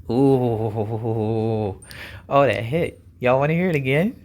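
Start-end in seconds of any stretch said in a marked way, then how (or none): none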